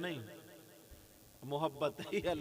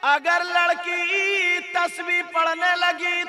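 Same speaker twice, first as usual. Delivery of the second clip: high, very loud drawn-out chanting cry; ringing, sustained preacher's chant; full-force preaching; 12 semitones higher, 22 dB louder, slower than usual. Real, not acted. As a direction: preach it at full volume, stretching key words.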